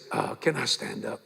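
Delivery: low, soft post-surgery croak; scratched-throat voice. Retold or imitated. Heart patient asking the nurse to let him stand, hoarse. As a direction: ask it quietly, throat sore from the tube. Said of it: "it kinda scratches your vocal cord".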